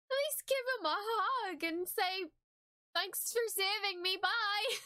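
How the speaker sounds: choked-up plea for a goodbye hug; crying while talking. Real, not acted. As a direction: talk through real tears at the farewell. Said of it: "Why am I crying so much".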